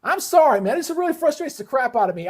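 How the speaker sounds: can't hold the frustration down; real worked-up venting. Real, not acted. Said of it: "I'm getting worked up a little bit".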